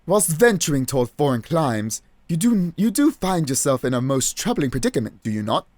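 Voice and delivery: put-on grandly measured British accent; hammed-up gentleman sleuth; pompous British detective. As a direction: hold forth like a self-assured British detective.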